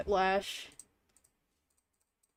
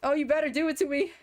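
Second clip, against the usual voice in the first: rising, louder, near tears with hope; excited pleading; 7 semitones higher, 3 dB louder than usual. Real, not acted. Streamer begging the game to give the prize, excited pleading. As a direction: beg the game breathlessly, voice climbing.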